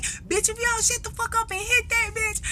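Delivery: high, pushy command; high taunting order; mock bully order. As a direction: egg her on like a bully.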